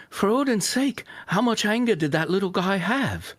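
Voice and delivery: put-on high, strained British accent try; attempted British accent. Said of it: "that wasn't British".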